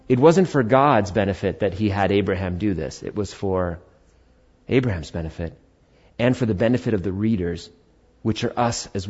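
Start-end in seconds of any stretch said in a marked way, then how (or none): none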